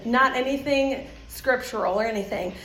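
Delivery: normal talking voice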